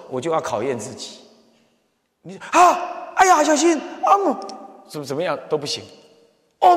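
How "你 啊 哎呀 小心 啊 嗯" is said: high, sudden yelps of alarm; jolted awake by the brakes; startled cry. Retold or imitated. (急刹车时惊叫)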